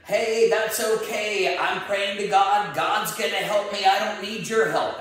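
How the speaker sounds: high, loud acted holler; mock shout to someone high overhead; shouting back up. Man on roof to helicopter crew, shouting back. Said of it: "shouts back up at him".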